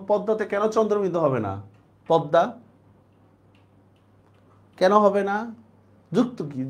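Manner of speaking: ordinary talk, nothing marked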